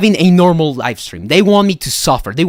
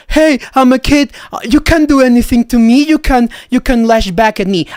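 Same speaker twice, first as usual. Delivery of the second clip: higher whiny mock child; mock kid; 8 semitones higher than usual. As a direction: whine it like a bratty twelve-year-old. Mocking troll's excuse.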